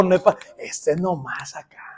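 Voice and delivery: conspiratorial whisper. Real, not acted. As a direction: whisper it like a secret to the congregation. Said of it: "todo esto es circuito cerrado". (susurro cómplice, como un secreto)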